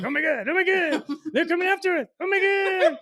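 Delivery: loud, very high mock panic; hammed-up squeal of terror; Audrey freaking out. Retelling how the wasps came after her, mock freak-out. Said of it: "she starts freaking out".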